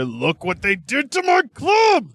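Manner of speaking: high, drawn-out wail of outrage; big outraged cry. Shown as a demonstration. Host showing how the line was meant to go, outraged shout. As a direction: belt it out like a melodramatic club owner. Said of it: "it sounds like he's meant to go".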